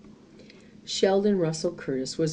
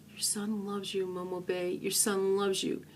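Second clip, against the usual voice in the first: softer, broken tender farewell; sobbing softly; 10 dB quieter than usual. A narrator's reading. Pink kissing dead Momo Bay, soft sobbing. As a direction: say goodbye through tears. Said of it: "he sobbed".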